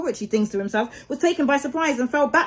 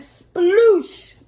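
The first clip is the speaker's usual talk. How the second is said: splash sound; 9 semitones higher, 6 dB louder than usual. A narrator's reading. Boat tips into river.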